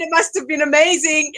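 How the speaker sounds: ordinary talk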